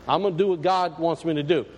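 usual speaking voice

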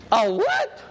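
high, startled "a what?"; jolted as if he misheard; shocked disbelief. Retold or imitated. Drinking man to the pastor, startled high disbelief.

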